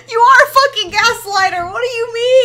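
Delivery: loud, high outburst of accusation; outraged accusation. Real, not acted. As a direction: explode at the other person, outraged.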